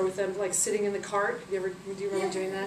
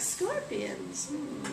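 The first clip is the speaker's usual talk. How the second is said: soft, knowing grumble; suspicious muttering; 4 semitones higher, 7 dB quieter, much slower than usual. Acted. Isis hearing of the killing, quiet suspicion. Isis suspects her scorpions.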